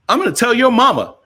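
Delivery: high, loud, quick playful threat; mock tattling threat. Wife's teasing threat, acted in story.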